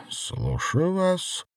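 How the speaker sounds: drowsy, slow voice on the phone; sleepy woman. A narrator's reading. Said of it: "произнёс сонный женский голос".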